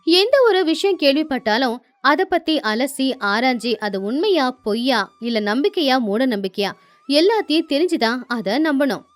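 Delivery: ordinary delivery, nothing marked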